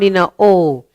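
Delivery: ordinary delivery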